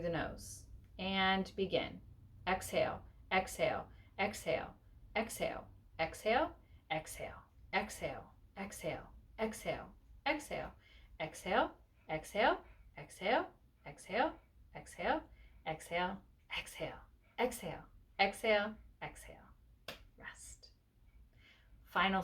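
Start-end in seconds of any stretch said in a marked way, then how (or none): 2.5-19.4 soft, steady breathing count; quietly pace each exhale; rhythmic counting cue. Shown as a demonstration. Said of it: "I am just gonna be counting and saying exhale". 20.2-20.7 soft whispered cue. Real, not acted. End of breathing round.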